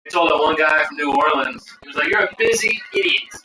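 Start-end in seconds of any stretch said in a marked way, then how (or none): 2.1-3.3 mock insult. Retold insult to New Orleans guy.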